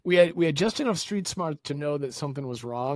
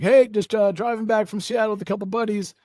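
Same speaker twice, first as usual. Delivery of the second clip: breezy driver at border; 8 semitones higher, 6 dB louder than usual. Retold or imitated. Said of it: "he's like".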